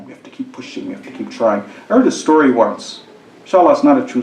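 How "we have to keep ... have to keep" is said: unvoiced hushed repeat; soft whispered urging. Shown as a demonstration. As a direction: whisper it intently. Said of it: "Who wants it more?".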